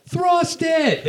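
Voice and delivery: high mock yell from the crowd; retold heckle yell. Heckling the comic onstage, a high mock yell. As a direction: shout it up at the comic, high and sharp. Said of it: "we would just yell".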